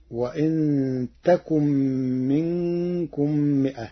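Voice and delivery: تكن said with ta, slow and drawn; Warsh reading with ta. Shown as a demonstration. Teacher demonstrates Warsh's تكن with ta, slowly. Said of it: "قرأ ورش كلمة يكن بالتاء".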